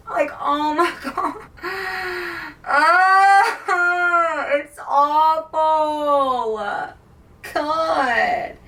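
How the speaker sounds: high, drawn-out tearful wail; half-laughing sob stretching into a long wail; crying in distress. Real, not acted. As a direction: wail as if heartbroken. Breaks down over the book.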